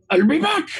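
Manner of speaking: high-pitched imitation of a parting threat; mock defiant cry. Dante's shout heard from outside.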